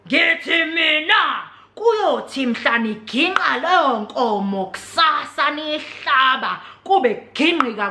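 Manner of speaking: slow, menacing elder voice; grandfather's threat. Retold or imitated. Grandfather threatening the family, slow and menacing. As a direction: threaten slowly, like an old man.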